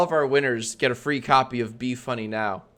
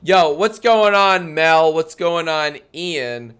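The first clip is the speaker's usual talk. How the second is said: excited; 4 semitones higher, 7 dB louder, slower than usual. Acted. Host greeting contestants loudly.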